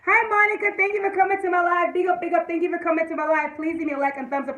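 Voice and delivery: high, loud welcome easing down; big bright-pitched shout-out, gradually settling; calling out greeting. Real, not acted. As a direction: hail a viewer joining the livestream, pitched high. Greets viewer joining the live.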